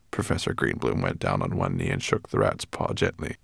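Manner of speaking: plain reading voice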